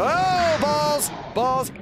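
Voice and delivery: high-pitched panicky reaction to danger; alarmed. Real, not acted. Player to self as danger rushes in, alarmed. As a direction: blurt it out as the danger comes.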